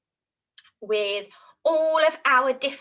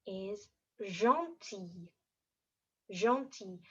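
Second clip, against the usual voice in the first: softer, slow and tender; gentle and soft; 3 semitones lower, 8 dB quieter, slower than usual. Shown as a demonstration. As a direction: say it like a big hug. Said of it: "our action for gentil is gonna be like a big hug".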